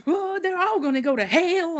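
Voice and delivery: high, wailing mock sermon; fire and brimstone preacher. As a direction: wail it like an overwrought preacher. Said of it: "And he's like".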